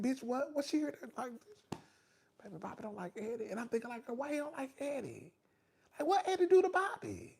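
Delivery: hushed imitation of gullible wondering; puzzled inner thoughts. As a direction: play private confusion in a small voice. Retells her private puzzled thoughts.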